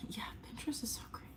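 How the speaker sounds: whisper. Real, not acted. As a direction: rush it out under your breath. Whispered aside.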